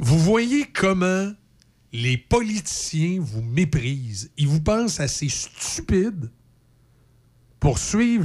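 slow, drawn-out preaching tone; preachy guru. Shown as a demonstration. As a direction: preach it slowly to the crowd. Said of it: "t'es pas un gourou".

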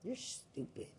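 unvoiced, contemptuous insult; hissed insult. Real, not acted. Speaker insulting accusers in a contemptuous hiss. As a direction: spit the insult out without voice.

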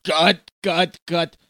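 calling cut. Acted. Director calling cut on set.

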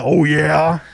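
lightly growled rock-singer catchphrase; a hint of the singer's signature shout. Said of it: "he's done the".

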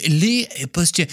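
playful mock French accent; fake French accent. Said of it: "I said that in my fake French accent".

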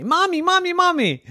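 put-on very high, urgent little-girl call; child calling mommy. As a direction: squeal for your mother. Child calling her mother.

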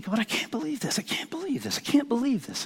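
high, very soft repeated marvel; amazed disbelief. Retold or imitated. Preacher lying sleepless, thinking to God, amazed disbelief. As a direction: act out hushed, giddy disbelief.

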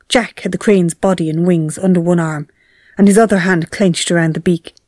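normal talking voice

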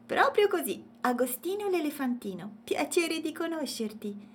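high, lively character voice; cheerful little elephant. A narrator's reading. Elephant introduces himself to Matilde, cheerful. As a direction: introduce yourself in a high, friendly voice.

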